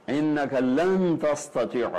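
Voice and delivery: لن stressed with a falling tone; emphatic stress. Shown as a demonstration. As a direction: lean hard on لن, slowly. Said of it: "شدت ہے". (لن پر زور)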